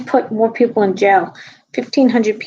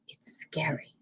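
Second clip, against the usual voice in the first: whisper; 4 semitones lower, 16 dB quieter, slower than usual. Real, not acted. Conspiratorial aside about Kamala.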